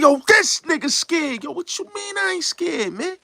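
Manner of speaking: way up in pitch, mocking and incredulous; high character quote. Retelling the Juice scene, high mocking quote.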